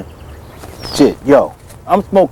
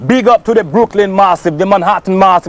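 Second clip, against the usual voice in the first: hyped-up Jamaican dancehall patois; shout-outs in a drawn-out Jamaican accent; 4 semitones higher than usual. Acted. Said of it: "chill out with that Jamaican accent".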